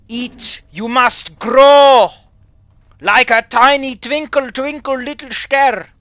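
high, loud, drawn-out theatrical voice; hammed-up booming incantation; mock grand command. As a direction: declaim it, stretching each word. Commanding a character to grow.

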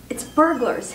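usual speaking voice

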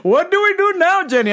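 flustered dad asks what now; panicky dad. Retold or imitated.

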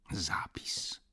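"Zapis" repeated as a soft voiceless whisper to himself; muttered whisper. A narrator's reading. Szuman pacing the room, muttering the word to himself in a whisper. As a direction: breathe "Zapis" under the breath. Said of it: "Mruczał Szuman".

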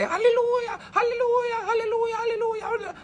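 high-pitched, wailing hallelujahs on repeat; mock-ecstatic church cry, way up in pitch; church hallelujah outburst. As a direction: mimic someone seized by the Spirit, rapid and high. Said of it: "jump out and say".

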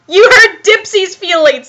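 lightly voiced wounded toy character; hurt Teletubby. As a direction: pipe up as a hurt little creature.

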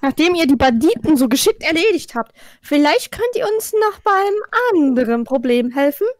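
usual speaking voice

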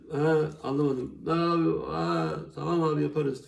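nasal whine. Retold or imitated. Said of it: "Böyle salak salak mıy mıy mıy mıy konuşursanız".

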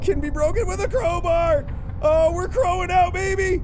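high-pitched excited holler; hyped up. Real, not acted. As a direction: crow it out after a scare. Finds crowbar, cheering self.

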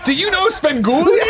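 high, excited put-on voice; mock starstruck fan. Host plays an amazed Chicago local quizzing the guest, mock starstruck excitement.

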